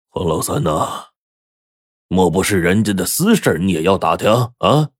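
put-on elderly voice, drawing out the name after a cough; throat-clearing old man. A narrator's reading. Old roommate warns his friend off prying, pointed throat-clear first. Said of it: "咳嗽了一声".

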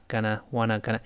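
wanna and gonna tossed off lightly; wanna, gonna barely stressed; light quick reduction. Shown as a demonstration. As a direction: skim over gonna and wanna. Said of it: "它一带而过".

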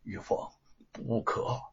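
softly, hushed and held back; hushed plea to his wife; low voice. A narrator's reading. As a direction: keep it low and urgent. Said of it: "低声地说".

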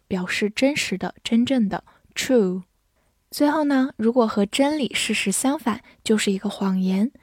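usual speaking voice

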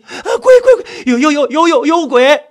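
high, loud stuttering terror; panicked yell. Acted. Narrator screaming that there is a ghost, terrified.

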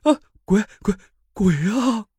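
吓得尖声大叫; 被鬼吓坏了地高声喊叫; 惊恐大叫. A narrator's reading. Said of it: "吓得大叫了一声". (惊恐地高声大叫)

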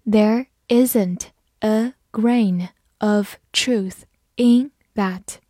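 deliberate word-by-word reading; slow reading. Shown as a demonstration. Teacher models the example sentence once for learners, slow reading. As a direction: read it slowly, leaving gaps between words. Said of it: "慢读一遍".

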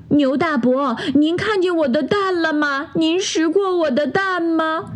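high, worried little duck voice; a fretful mother duck searching; anxious duck mother. Acted. (着急找蛋的鸭妈妈，高声询问)

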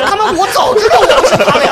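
exasperated, laughing through it; cracking up at the absurdity; laughing retort. Retold or imitated.

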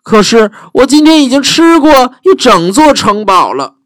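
high, puffed-up dragon bragging; a full, lazy dragon brushing her off. Acted. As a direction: dragon turning the princess away, high and smug.

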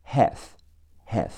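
American short-a "haff"; American pronunciation. Shown as a demonstration. Teacher shows learners American half, American accent with a front "a". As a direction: say half the American way, vowel as in cat. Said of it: "美式发音是和哎嘿".

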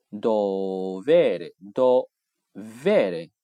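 dovere stressed on ve, said very slowly; penult stress. Shown as a demonstration. Teacher contrasting penultimate stress, very slow. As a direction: stretch dovere slowly with weight on ve. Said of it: "倒数第二个音节重读的".

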